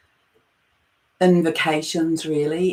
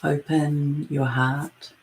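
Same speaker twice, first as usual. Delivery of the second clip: guided meditation; 2 semitones lower than usual. Acted. Leading listeners into their breath and heart space, guided meditation. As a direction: ease listeners inward, phrase by unhurried phrase.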